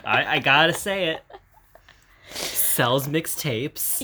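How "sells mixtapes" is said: slow deadpan. Real, not acted.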